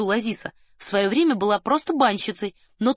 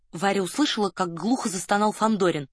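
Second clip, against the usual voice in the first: plain reading voice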